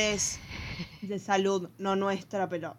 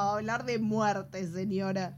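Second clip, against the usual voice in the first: normal talking voice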